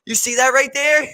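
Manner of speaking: high, loud, pointing it out; loud, high mock judge exclaiming at the picture; incredulous judge. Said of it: "he's like".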